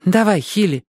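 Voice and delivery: ordinary delivery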